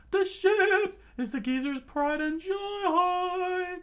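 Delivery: very high, loud, slow lilting line; high sing-song voice. Acted. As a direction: proudly declare. Sanji defends the ship.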